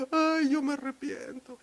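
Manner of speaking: high, slow put-on wail; mock crying. Prisoner claiming repentance in jail, mock crying. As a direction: drag it out like a fake weeper. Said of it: "están llorando".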